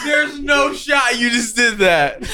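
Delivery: slow, high, laughing disbelief. Real, not acted. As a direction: cry it out through laughter. Reacting to the squeal.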